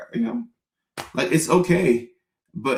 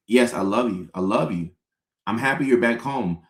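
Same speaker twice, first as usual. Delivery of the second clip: usual speaking voice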